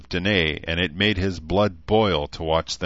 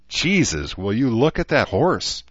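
gasping; 5 semitones higher, 4 dB louder than usual. A narrator's reading. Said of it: "Cruz gasped".

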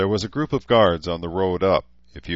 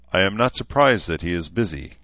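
plain reading voice

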